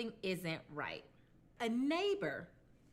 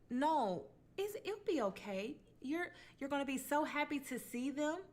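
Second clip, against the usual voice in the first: higher, coaxing neighbor voice, lightly voiced; reassuring neighbor; 7 semitones higher than usual. Neighbor reassuring the son about his parents, higher coaxing voice. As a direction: soothe him like a worried neighbor. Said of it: "And she was like".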